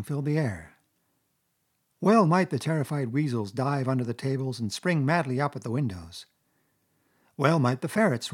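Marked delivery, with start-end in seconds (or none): none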